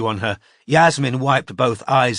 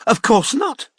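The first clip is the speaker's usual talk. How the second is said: with a hint of a gasp; 12 semitones higher, 4 dB louder than usual. A narrator's reading. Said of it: "with a hint of a gasp".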